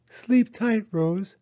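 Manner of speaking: put-on high, eager child's voice; little girl Penny. Penny asking Mama about snow, high childlike voice. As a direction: ask like an impatient little girl.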